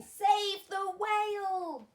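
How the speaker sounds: high, loud, slow proclamation; big announcement. A narrator's reading. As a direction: spell out a rescue call. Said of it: "a silvery trail saying".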